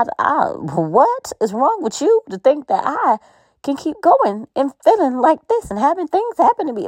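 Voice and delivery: high-pitched exasperated rant at God; retold frustrated rant. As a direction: replay the anguished complaint.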